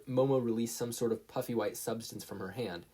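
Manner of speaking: ordinary delivery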